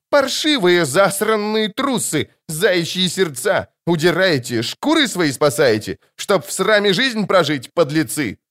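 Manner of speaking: high, drawn-out scolding yell; indignant roar. A narrator's reading. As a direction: berate deserters in a high, ringing voice. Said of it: "рявкнул ... таким голосом". (гневно, высоко кричит на трусов)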